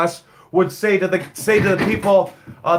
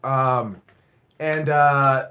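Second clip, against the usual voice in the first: normal talking voice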